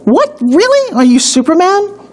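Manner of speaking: high incredulous disbelief; surprised. Acted. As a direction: act astonished at the news. Mock disbelief at the claim.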